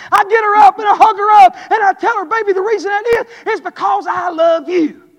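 loud, high, driving preacher's voice; fiery preaching. Acted. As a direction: preach it hard to the congregation. Preaching at full force.

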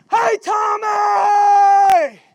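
long, slow, loud drawn call. Retold or imitated. Rocky calling up from the ground, drawn-out loud call. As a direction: stretch the call out to him. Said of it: "Rocky looked up and he said".

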